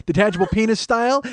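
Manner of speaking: usual speaking voice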